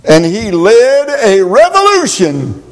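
rising to a preached shout; preaching at full force. Acted. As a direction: build it up and shout. Preaching climax.

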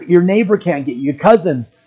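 usual speaking voice

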